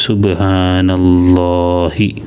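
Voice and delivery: സുബ്ഹാനല്ലാഹി drawn out slowly, each syllable clear; slow model reading. Shown as a demonstration. Teacher reads the finished word slowly to pupils. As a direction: read സുബ്ഹാനല്ലാഹി very slowly for the class. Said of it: "സു സീനിന് ലൊമ്മ്".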